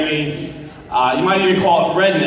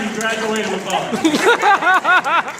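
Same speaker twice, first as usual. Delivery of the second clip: laughing; 5 semitones higher, much slower than usual. Real, not acted. Punchline through laughter.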